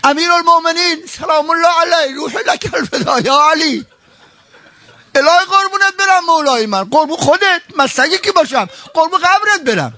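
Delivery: high, crying-out devotion; high keening devotional appeal; devotional lament. Acted. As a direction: cry out your love to the Imam.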